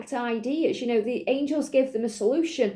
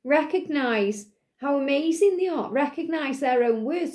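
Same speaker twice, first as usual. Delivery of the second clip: ordinary delivery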